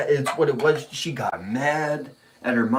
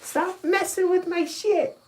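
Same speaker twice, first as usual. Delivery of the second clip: put-on high squeaky kid's complaint; little girl voice. Dad imitating his daughter scolding her mom, high squeaky voice. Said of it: "I could just".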